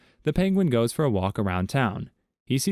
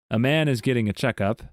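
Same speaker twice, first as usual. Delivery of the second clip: ordinary delivery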